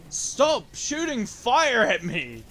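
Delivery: loud, slow, high protest; annoyed yell. Real, not acted. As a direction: yell at the enemy. Enemy attacks in game.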